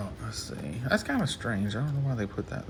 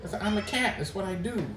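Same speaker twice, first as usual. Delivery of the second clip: high, cutesy voice for the cat's side; speaking as the cat; 9 semitones higher than usual. Pretend. Speaking for the cat about why it climbs on him, in a high cutesy voice. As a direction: answer for the cat in a high pet voice.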